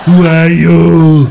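put-on Arnold asking a question in a heavy Austrian accent; Schwarzenegger impression. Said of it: "That voice he uses when he asks a question".

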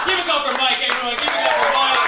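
loud, high emcee call for applause; rally the crowd, loud and high. Real, not acted.

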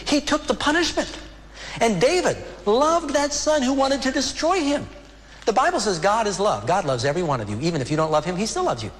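high-pitched, urgent pulpit voice; impassioned preaching. Real, not acted. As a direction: preach it with rising fervor. Preaching at full fervor.

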